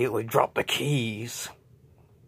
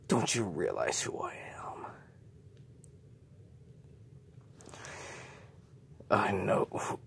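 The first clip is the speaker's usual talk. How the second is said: creature whispering; 4 semitones lower, 6 dB quieter than usual. Acted. Creature taunting J J.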